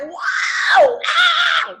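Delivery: very high, loud shout of wonder; awestruck ambassador. Retold or imitated. Coach voicing an ambassador dazzled by the top rank, high awed shout. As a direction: gasp it out, dazzled. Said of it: "an ambassador is like".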